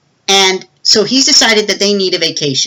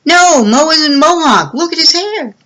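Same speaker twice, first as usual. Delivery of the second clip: put-on kid's reply; 10 semitones higher, 3 dB louder than usual. Kid correcting brother about name.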